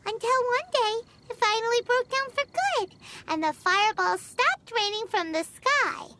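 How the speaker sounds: usual speaking voice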